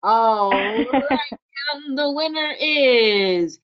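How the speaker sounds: high, drawn-out announcer delivery; radio voice. Pretend. Host announces the prize winner to agents, high radio voice. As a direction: proclaim the winner like a radio host, stretching the name. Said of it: "use my radio voice here".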